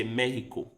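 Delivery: Mexico said as "Meh-hee-ko", X as a throaty H; Spanish Mexico. Shown as a demonstration. Teaching chat the Spanish X, Mexico with an H. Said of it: "Let's say Mexico instead of Mexico".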